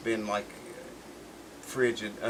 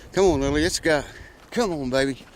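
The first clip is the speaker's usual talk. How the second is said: louder call to hurry the dog along; 4 semitones higher, 5 dB louder than usual. Real, not acted. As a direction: call the dog on through the snow. Urging the dog through snow.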